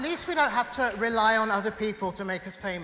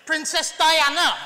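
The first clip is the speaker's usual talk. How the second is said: triumphant loud; 5 semitones higher, 8 dB louder than usual. Acted. Trump card in contest.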